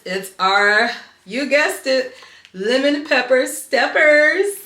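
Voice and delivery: loud, slow, drawn-out reveal; excited reveal. Real, not acted. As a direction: announce it like a big reveal. Big reveal of favorite shoes.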